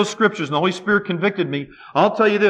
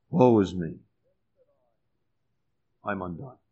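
hushed despair; 11 semitones lower, 9 dB quieter than usual. Acted. Quoting Isaiah's despair.

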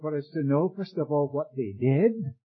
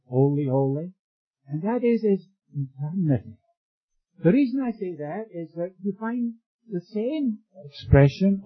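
usual speaking voice

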